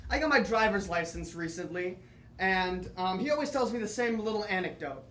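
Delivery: ordinary talk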